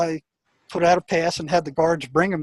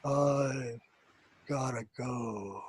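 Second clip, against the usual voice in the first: much lower, very slow and hushed, eerie; strange trance-like affect; 5 semitones lower, 13 dB quieter, much slower than usual. Retold or imitated. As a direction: drag it out low and quiet as if in a trance. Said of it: "with this strange affect".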